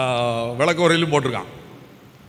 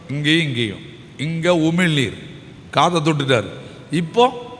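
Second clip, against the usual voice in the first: ordinary delivery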